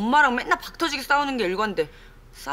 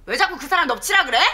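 loud, indignant complaint; indignant protest; 3 semitones higher, 6 dB louder than usual. Acted. Daughter, louder, defending her boyfriend to her mother indignantly. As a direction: push back hard for him.

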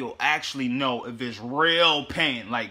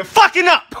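scolding shout; 11 dB louder than usual. Real, not acted. Scolding viewers about water.

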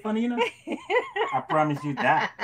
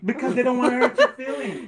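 laughing, louder tease; 8 semitones higher, 7 dB louder than usual. Real, not acted. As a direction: say it through laughter. Laughing teasing.

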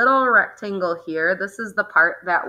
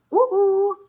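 playful woo-hoo; 13 semitones higher than usual. Real, not acted. Playful cheer about numb face.

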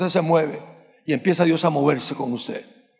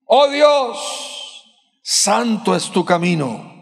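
preaching at full force; 3 semitones higher, much slower than usual. Acted. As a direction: proclaim the psalm to the church. Preacher reads psalm with force.

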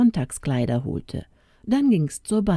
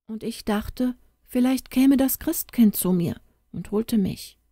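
put-on lightly raised little girl voice, sad; a young girl telling grim news, voice lifted higher; child Tona; 6 semitones higher than usual. Little girl tells the stranger her parents died, higher child voice.

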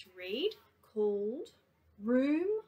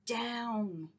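ordinary delivery, nothing marked

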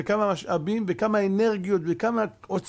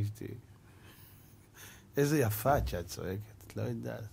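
soft sweet-talking; 8 semitones lower, 8 dB quieter than usual. Retold or imitated. Husband disarming his shouting wife with compliments, soft sweet-talking. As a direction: imitate a tender husband, low and very soft.

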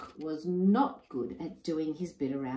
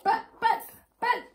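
much higher, louder stammering buts; spluttering; 5 dB louder than usual. Acted. Husband spluttering as his wife leaves. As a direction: splutter in shock. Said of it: "spluttered Enoch".

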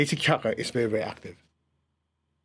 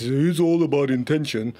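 slow, mock-earnest guru voice; lightly voiced smug believer; mocking a dreamer. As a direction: parody the people who only talk about intention. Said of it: "some people, it's".

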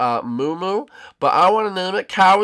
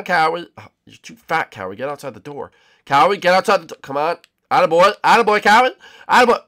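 usual speaking voice

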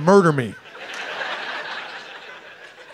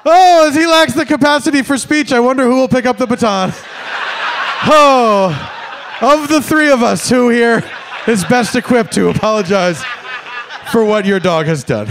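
sarcastic loud; 6 semitones higher, 5 dB louder than usual. Pretend. Pointed sarcasm at owner.

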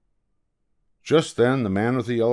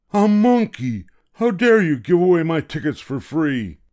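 high, louder outraged scolding; indignant seller; 8 semitones higher, 4 dB louder than usual. Acted. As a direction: scold the monkey for giving tickets away. Seller catches monkey.

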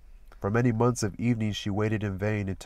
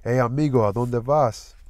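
a higher, louder call from the gate, Spanish greeting; calling out sweetly; 3 semitones higher, 6 dB louder than usual. Acted. Sweets at her gate calls to passing Danny, raised and inviting. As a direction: call him over from the front gate. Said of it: "voice sounded as huskily sweet".

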